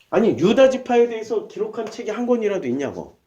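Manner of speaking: normal talking voice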